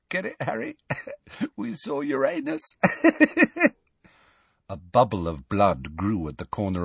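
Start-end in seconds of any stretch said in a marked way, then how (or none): 0.1-2.6 high, giggly and soft; giggling. A narrator's reading. Ron giggles his joke at Harry, high and soft. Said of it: "still giggling feebly". 2.7-3.7 feeble high-pitched giggle. A narrator's reading. Ron giggling at his own joke, high. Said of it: "still giggling feebly".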